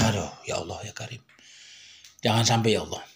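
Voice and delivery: despairing sigh. Real, not acted. Despairing plea to God.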